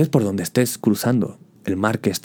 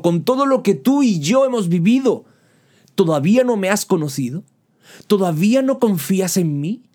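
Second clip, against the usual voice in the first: impassioned plea; 7 semitones higher than usual. Acted. Preacher voices Jesus, rising fervor.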